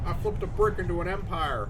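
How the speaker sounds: hammed-up rapper brag; mock rapper boast. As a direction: spit it like a rap line. Rapping as Dracula Flow.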